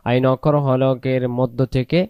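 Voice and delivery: ordinary talk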